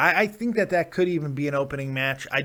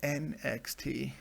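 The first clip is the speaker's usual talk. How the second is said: mock sexy; 6 dB quieter than usual. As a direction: say it soft and smooth, playfully seductive. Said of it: "Oh, I said that sexy".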